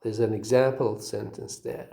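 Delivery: ordinary delivery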